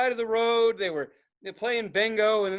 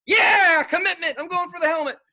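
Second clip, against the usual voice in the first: high, loud triumphant shout; retold yell; 9 semitones higher, 8 dB louder than usual. Driver shouting as he runs over the helmet. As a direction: roar it like charging in. Yelling while driving.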